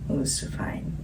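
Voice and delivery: hushed whisper. Real, not acted. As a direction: breathe it out as a whisper. Musing line whispered.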